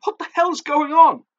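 high, loud, shocked demand; loud, high-pitched disbelief. A narrator's reading. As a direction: blurt it out in shock. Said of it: "I asked in shocked tones".

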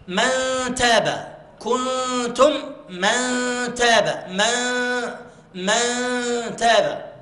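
من تاب with a light nasal before the ت; slow, light nasal hum in من تاب; light ghunna. Shown as a demonstration. Teacher comparing examples for the class. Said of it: "لأن هنا الحركة الفتح".

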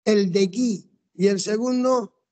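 ordinary talk

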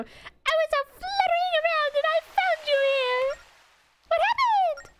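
high, irritating fairy; annoying fairy voice. Acted. Fairy greeting someone who just woke, annoying high voice. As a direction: pipe up high as a pesky fairy. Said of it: "It has to be an annoying voice".

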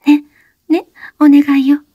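put-on high young woman's voice, urgent plea; breathless high-pitched plea; Oshino, pleading. A narrator's reading. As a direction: beg him to stop talking.

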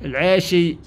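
ordinary talk, nothing marked

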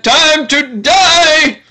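rising, drawn-out mock war cry; mock battle cry. Player threatening dirt in the game, hammed-up battle cry. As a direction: stretch it out like a playful threat.

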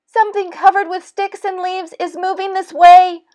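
high, fast, loud squirrel voice; alarmed little animal raising a warning; crying squirrel. A narrator's reading. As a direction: rattle it out as a panicked squirrel. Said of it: "cried a squirrel".

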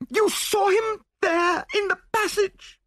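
shrieked question, very high and drawn out; terrified cry. A narrator's reading. Widow who has seen her dead husband, crying out in terror. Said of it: "she cried".

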